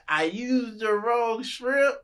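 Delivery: mock whiny excuse. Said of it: "You gonna be".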